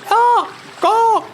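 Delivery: very high, loud bird squawk; a seagull squawking in Haslin's face. Acted. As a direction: squawk like a gull. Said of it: "The seagull squawks in his face".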